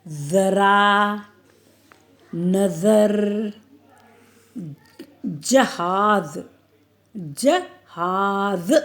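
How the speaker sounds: slow, careful word reading, each ज़ sounded as z; stretched, deliberate spelling-out voice; clear z sounds. Shown as a demonstration. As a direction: read each word slowly for children. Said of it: "तो ज़ पढ़ते हैं".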